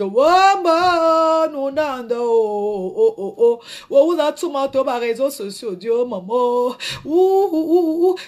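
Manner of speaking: drawn-out, theatrical wail; mock wailing. Playing someone carried off by ambulance, mock wailing.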